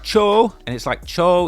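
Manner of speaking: high Brooklyn-accented CHO. Shown as a demonstration. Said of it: "hear the New Yorkers talking about it".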